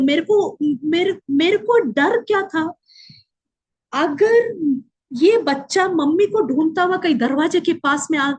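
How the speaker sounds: ordinary talk, nothing marked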